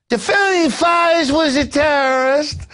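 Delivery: put-on slow, high country-boy caricature; country boy accent. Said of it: "I'm doing a country boy accent".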